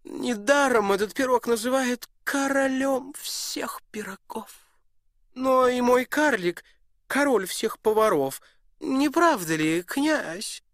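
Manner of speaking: high, loud boastful duke voice; proud duke. Acted. Duke boasting to the prince, high and loud. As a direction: show off your cook to the guest. Duke praises his dwarf.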